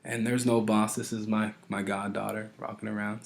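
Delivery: ordinary delivery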